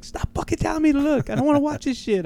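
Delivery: high, strained mock complaint; imitated annoyed partner. Partner fed up with wrestling, high-pitched mock irritation.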